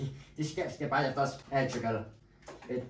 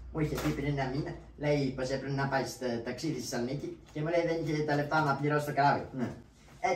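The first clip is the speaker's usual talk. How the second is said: ordinary talk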